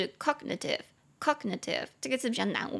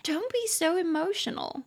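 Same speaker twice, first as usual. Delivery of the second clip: light mocking tease, pitched up; teasing a friend; 8 semitones higher than usual. Teasing a close friend about being worked up, playful and mocking. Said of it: "你想要跟他开玩笑".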